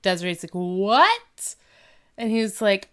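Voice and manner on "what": louder, swooping-up question; mock disbelief. Girlfriend just told no by her boyfriend, mock disbelief. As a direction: imitate a stunned girlfriend. Retelling Desiree's shocked reaction.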